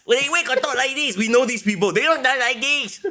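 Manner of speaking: high-pitched mocking fan voice; silly high voice, making fun; internet wrestling fan. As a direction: put on a squeaky caricature of online fans. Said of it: "is that your impression of the I W C".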